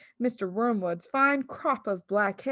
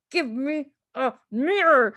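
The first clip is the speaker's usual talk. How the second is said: louder demanding yell; 5 semitones higher, 6 dB louder than usual. A narrator's reading. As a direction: bark for a mirror. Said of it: "the father yelled".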